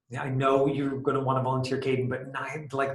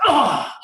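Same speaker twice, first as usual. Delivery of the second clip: cartoon yelp, high and loud; high cry of pain; 13 semitones higher, 9 dB louder than usual. Action hero hit by a bullet, high yelp. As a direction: yelp like you just got shot in an action movie. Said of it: "and then I'm like".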